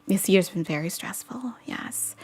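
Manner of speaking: breathy whisper, barely there; a secret confided to viewers; whispered aside. Real, not acted. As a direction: let it slip out under your breath. Whispered aside about stress.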